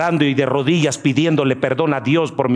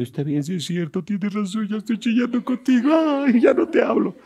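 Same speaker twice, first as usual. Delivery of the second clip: sulky believer; 11 semitones higher than usual. Retold or imitated. Believer sulks back at God in a high whine. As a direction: sulk like a hurt child.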